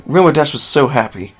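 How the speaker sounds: plain reading voice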